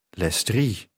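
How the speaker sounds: slightly hoarse murmured line; a touch of huskiness in the voice. A narrator's reading. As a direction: say it lightly husky, understated. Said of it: "met een heese stem".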